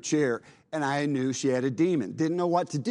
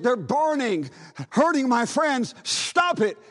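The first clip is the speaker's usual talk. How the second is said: higher and louder, rising to a much higher desperate plea; distressed girl; 7 semitones higher, 3 dB louder than usual. Retold or imitated. Joyce pleading for her demon friends, higher, louder desperate plea. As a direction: build into a frantic begging cry.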